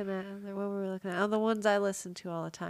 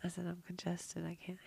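whispering; 10 dB quieter than usual. Real, not acted. Congested, says it's hard to talk.

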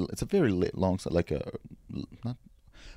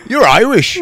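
loud, high accusation; mock indignant shout; 11 semitones higher, 9 dB louder than usual. Retelling an audience member's accusation, mock loud shout. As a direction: blurt it out like an affronted drunk.

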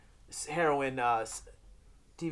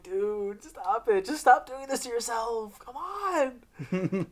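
high-pitched mock begging; exasperated pleading; 8 semitones higher, much slower than usual. Viewer begs hero to stop hurting himself, exasperated pleading. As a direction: plead with him in rising exasperation.

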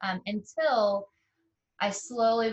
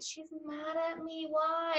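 hurt disbelief; 8 semitones higher, 5 dB quieter, slower than usual. Retold or imitated. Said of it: "And I'm like".

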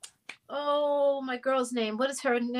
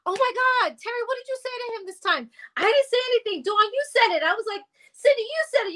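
put-on high, excited girlish chatter; panicked little girls; 8 semitones higher, faster than usual. Bandmates in the parking lot after being sent home, high and flustered. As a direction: play the scared girls blaming each other, pitched up. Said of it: "And we're like".

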